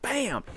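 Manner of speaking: high sharp bam; gunshot bam. Retold or imitated. Boy firing beside his dad, a high sharp bam. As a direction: blurt out a gunshot noise. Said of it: "And I'm like".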